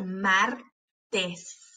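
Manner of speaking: "martes" slowly as mar, pause, tes; split into syllables. Shown as a demonstration. Teacher showing both closed syllables, syllable by syllable. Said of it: "para ver cuáles de esas sílabas".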